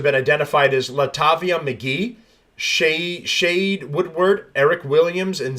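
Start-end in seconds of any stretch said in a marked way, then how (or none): none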